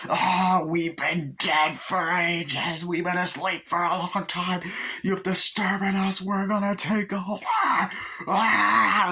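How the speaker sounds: high, strained, drawn-out demon impression; demonic possessed voice. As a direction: intone it like a possessed ghoul. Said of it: "the creepiness of it".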